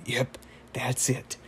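wizard. Retold or imitated. Wizard confirms his petty revenge to Rigby, slow and unbothered. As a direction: confirm it slowly and quietly, unbothered. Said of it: "And then he's like".

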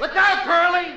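yell. Acted. [name] yells the warning from offstage, loud and high. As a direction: bellow the warning from the next room. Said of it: "I'll yell".

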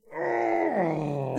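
high drawn-out howl that slides down; Vita howl. Shown as a demonstration. Host doing the Vita on request, high howl. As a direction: hold a high "ohhh", then let it fall. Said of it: "I'm doing the Vita".

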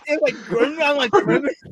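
high, laughing repeats of Grimace; laughing disbelief. Real, not acted. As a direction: giggle out the name in disbelief. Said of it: "I was laughing so hard".

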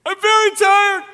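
high whimpering voice that breaks into a loud wail; exhausted whimper. Retold or imitated. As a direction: whine the line like a worn-out man who finally gives in. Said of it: "And I was like".

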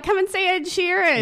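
excited announcer voice. Retold or imitated. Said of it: "They're like".

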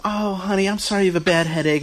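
mock-tender mother cooing over her son; mom's sweet voice. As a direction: mimic a fussing mother soothing her son. Mom comforting son at restaurant.

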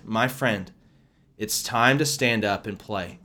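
usual speaking voice

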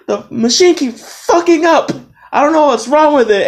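high, exasperated outburst; retold yell. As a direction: blurt it out at the boss, pitch way up. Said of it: "I almost yelled at my boss".

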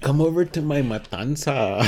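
hammed-up New Mexican invitation; New Mexican accent. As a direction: invite them in a mock New Mexican lilt. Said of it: "New Mexican Spanish".

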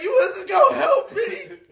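crying yell. Retold or imitated. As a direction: yell it through tears at someone who didn't help. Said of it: "And I'm like".

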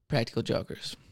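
ordinary delivery

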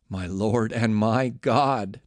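very slow, hushed-voiced awe; stunned, reverent exclamation; awed exclamation. A narrator's reading. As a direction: let the words fall out in reverent wonder. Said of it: "Thomas exclaimed".

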